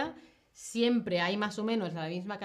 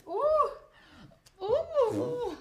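high whoops; 13 semitones higher, 3 dB louder than usual. Real, not acted. Student drawing, reacting.

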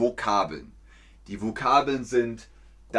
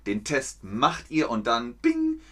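ordinary talk

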